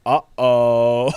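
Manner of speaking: mock uh-oh. Retelling a worthless movie character, mock alarmed uh-oh. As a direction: play the useless guy realizing he's in trouble.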